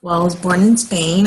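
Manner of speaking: ordinary delivery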